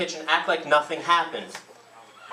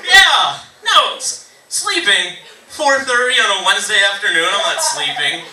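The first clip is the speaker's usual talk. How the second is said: high, loud, over-enunciated denial; hammed-up alertness on the phone; over-exaggerated awake voice; 4 semitones higher, 8 dB louder than usual. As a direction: overcompensate loudly for having just woken. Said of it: "over-exaggerate all of your, your words".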